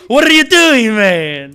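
greeting Armando, loud excited. Acted. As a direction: shout it like seeing an old friend.

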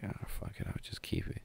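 whispered under breath; hushed resigned mumble; muttered aside. Retold or imitated. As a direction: whisper it to yourself. Pocketing the Eggo.

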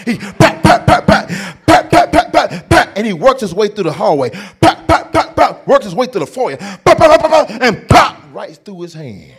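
loud, high, rapid pat pat rhythm; rising excitement. Retold or imitated. Telling the audience about dad nailing, loud and building. Imitated nail gun rhythm.

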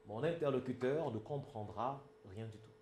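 ordinary talk, nothing marked

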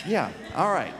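loud, high and laughing. Real, not acted. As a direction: laugh through the words. Laughing after the shout.

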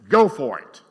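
loud, offhand go-ahead from God; God's permission. Acted. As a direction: toss it off like a shrug. God lets us do it.

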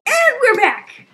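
high, loud, drawn-out announcement; excited return. Real, not acted. Player returns to viewers after a pause, excited shout. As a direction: burst back in loudly, high-pitched.

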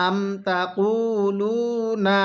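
drawn-out chanted recitation; slow melodic Quranic chant. Shown as a demonstration. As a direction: recite it like a tajweed teacher. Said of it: "কিভাবে পড়ব?".

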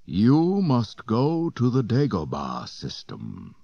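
slow commanding ghost voice; spectral instruction. A narrator's reading. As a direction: instruct slowly like a spirit. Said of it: "the spectral figure instructed".

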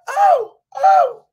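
mock girly shriek of surprise; high shocked girl's cry. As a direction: squeal it like the startled young dancer. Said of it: "And she like".

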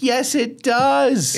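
high, drawn-out and slow "Yes, it does"; emphatic insistence. Retold or imitated. Telling a friend how she shut down Miller's claim, slow and high. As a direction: stretch it out, high and certain.

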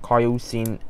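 plain reading voice

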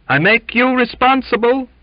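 high, loud, desperate accusation; frantic, high-pitched protest; desperate cry. A narrator's reading. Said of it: "he cried, desperately".

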